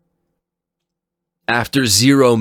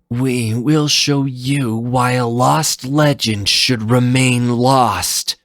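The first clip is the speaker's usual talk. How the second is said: slow, higher ominous voice; grim ninja warning; 8 semitones higher, 4 dB louder, slower than usual. Acted. Phantom warns the lost legend Zero, slow and ominous. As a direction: let the threat hang.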